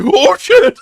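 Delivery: high startled "Oh, shit"; mock panic. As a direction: yelp as if caught out. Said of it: "He's like".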